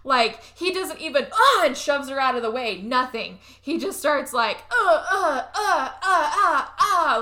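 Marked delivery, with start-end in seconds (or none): 1.3-1.6 short high, loud "ah"; mock cry of alarm. Imitates the boyfriend being attacked. 4.7-7.2 rhythmic high, loud grunts; mock getting-whacked grunts. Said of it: "like, getting whacked and stuff".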